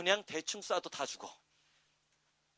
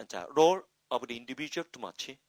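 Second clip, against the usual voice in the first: normal talking voice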